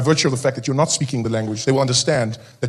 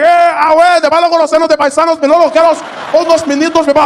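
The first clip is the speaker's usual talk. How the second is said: very high, loud, rapid Spanish; joyful Spanish shout; excited Spanish shout; 12 semitones higher, 6 dB louder than usual. Retold or imitated. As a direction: hail a compatriot excitedly. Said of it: "They're like".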